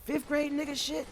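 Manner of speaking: put-on high, strained kid's whine; exasperated squeal. Fifth grader begging the teacher to chill, high whine. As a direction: whine it up high like a fed-up kid. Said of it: "I'm like".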